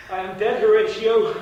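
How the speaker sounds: loud, high dying cry; anguished cry. Acted. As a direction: cry out in agony. Dying Hamlet cries out.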